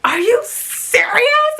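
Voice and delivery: high, slow amazed squeal; excited disbelief. Retold or imitated. Mom amazed at daughter's retweet news, high slow squeal. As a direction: stretch it out, thrilled. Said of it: "And I was like".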